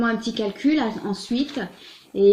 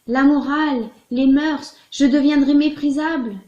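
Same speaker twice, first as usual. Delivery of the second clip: slightly raised, exclaiming quote; mild mock outcry; 4 semitones higher, 6 dB louder than usual. Letter writer imagines madame protesting, mild outcry. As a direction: exclaim it in short bursts, lightly indignant. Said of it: "Vous allez vous écrier et dire".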